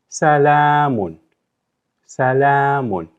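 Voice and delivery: Salamun said slowly, long la; slow model pronunciation. Shown as a demonstration. Teacher modelling for students to repeat, slow. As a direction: demonstrate Salamun slowly for learners to copy. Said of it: "try to do it".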